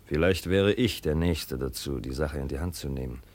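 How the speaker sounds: low hushed plotting; low confiding. Acted. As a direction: keep it hushed and conspiratorial. Son conspires with provost quietly.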